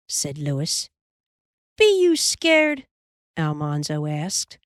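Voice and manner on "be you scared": high, slow teasing question. A narrator's reading. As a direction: taunt him, drawn out. Boy taunting the younger one.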